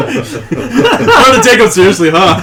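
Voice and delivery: laughing. Real, not acted. Laughing at the table.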